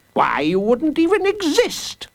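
high, indignant proclamation; enraged judge. A narrator's reading. Judge leading the big people against a child, enraged. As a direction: sing it out high in pompous outrage.

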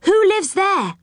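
put-on high-pitched, curious child; eager high-voiced query; surprised question. A narrator's reading. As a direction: ask in wonder, voice lifted high. Surprised boy asks about the door.

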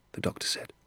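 ordinary delivery

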